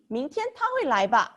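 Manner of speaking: fast, light model of "吧"; tossed off quickly, sure of the answer; a fast question expecting yes. Shown as a demonstration. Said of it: "So maybe".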